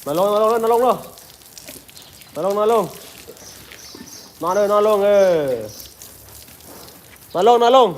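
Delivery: high-pitched command to an elephant; high coaxing command. Real, not acted. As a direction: sing the orders up high to coax the elephant. Ordering elephant to lie down.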